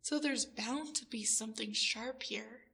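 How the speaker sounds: normal talking voice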